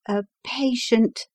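the doctor's wife, lightly voiced; wife's voice. A narrator's reading. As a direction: speak up a bit as the wife. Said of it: "said she".

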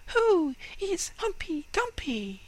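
high, tiny mouse voice; squeaky little mouse. Acted. Mice speak in squeaky voice.

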